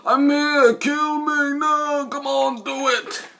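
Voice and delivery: high, loud pleading taunt; mock a desperate hero begging; whiny Adrian Brody. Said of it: "Adrian Brody going".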